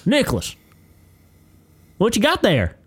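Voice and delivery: put-on high, loud coach calling out a kid; confrontational coach. Coach spots a student playing with toys, confrontational. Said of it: "starts laying into this kid".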